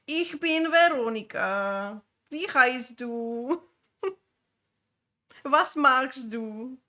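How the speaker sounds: ordinary delivery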